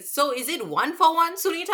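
high-pitched mock uncle wheedling; uncle impression. An uncle asking the supervisor for one-for-one, mock wheedling. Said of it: "this one uncle, he was like".

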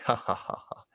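mock "ha ha ha" laughter; imitated laugh. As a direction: fake a villain's laugh, spoken lightly. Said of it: "Martin starts to laugh".

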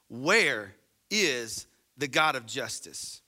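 slow, solemn Bible reading; scripture reading. Acted. Pastor reading Malachi to the congregation, slow and solemn. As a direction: read the verse slowly and gravely. Reads scripture verse aloud.

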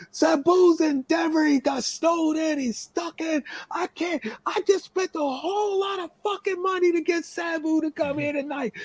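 frantic, very high-pitched rant; retold yelling fit, voice stuck up high; going nuts. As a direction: imitate a promoter melting down over a disaster. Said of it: "He's just going off".

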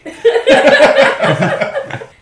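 high, loud real laugh; burst of laughter. Real, not acted. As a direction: laugh hard at the joke. Laughs at player's joke.

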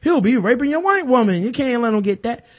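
mimicking old drug-war propaganda, alarmed; mock fearmongering official. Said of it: "by being like".

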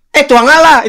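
loud, high mock eastern Indonesian exclamation; hammed-up eastern Indonesian outburst; eastern Indonesian loud speech. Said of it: "kalau ngomong kenceng tuh".

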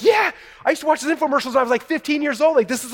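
high, fast gushing like a starstruck fan; fangirling excitement. Retold or imitated. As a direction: blurt it out, totally freaking out. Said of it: "totally fangirling and freaking out".

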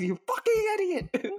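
very high, sneering insult; mocking high voice. Acted mocking quote.

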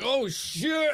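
high startled yelp; panicked driver yelp. Retold or imitated. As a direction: yell it in panic. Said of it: "He's like".

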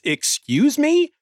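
high, slow, disbelieving excuse me; hammed-up outrage at being asked; incredulous. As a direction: stretch it out in mock disbelief. Frank balks at request.

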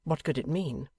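ordinary delivery

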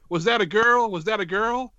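lightly voiced old-timey announcer. Shown as a demonstration. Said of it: "a slightly old-timey voice".

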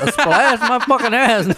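high, loud scoff with a laugh; laughing mock outrage. Friend claims only a splash of vodka, so he scoffs loudly while laughing. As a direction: shout back, laughing at the lie. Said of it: "I was like".

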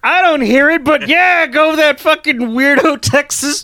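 exaggerated disbelief at a weirdo pilot; hammed-up incredulous rant; mock exasperation. Mock reaction to the fake Texan.

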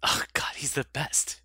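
breathy gushing whisper. Real, not acted. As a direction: whisper it, starstruck. Gushing over Goldblum.

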